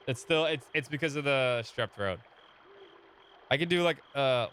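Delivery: ordinary talk, nothing marked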